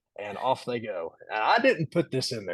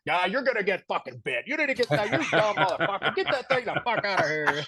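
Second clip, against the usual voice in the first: put-on light Detroit accent, laughing through it; a hint of a Detroit guy yelling, pitched up; loosely imitated Detroit accent in a laughing rant; 7 semitones higher than usual. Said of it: "he's got the accent and everything".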